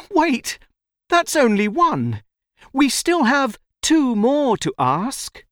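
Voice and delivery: put-on high-pitched, urgent child; boy crying out. A narrator's reading. Boy stops the tiger eating him, crying out. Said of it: "but the boy cries".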